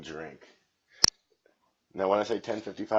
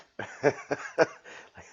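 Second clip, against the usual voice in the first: breathy giggle; 7 semitones higher than usual. Real, not acted. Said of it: "laughs at own joke".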